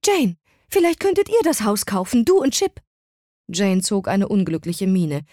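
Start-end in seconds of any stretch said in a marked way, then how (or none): 0.1-2.8 viel höher, plötzlich hoffnungsvoll. A narrator's reading. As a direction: als hätte sie die rettende Idee. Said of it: "Sie klang plötzlich hoffnungsvoll". (Mutter schlägt Jane hoffnungsvoll den Hauskauf vor)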